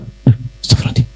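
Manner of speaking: quick breathless gasp of alarm, whispered; startled whisper. Retold or imitated. Gentle wife jolted by husband's shout, startled whisper.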